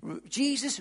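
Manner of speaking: usual speaking voice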